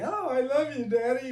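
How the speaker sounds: high, sugary mock-loving child voice; fake sweet child. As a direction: play a child lying sweetly to Daddy. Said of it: "lying through their teeth".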